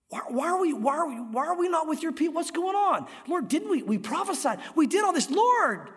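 high, pleading disbelief; bewildered pleading crowd. Retold or imitated. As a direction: beg the Lord as if shut out in confusion. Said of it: "just going like".

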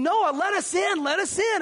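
pleading crowd. Retold or imitated. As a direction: beg through the hull to be let in.

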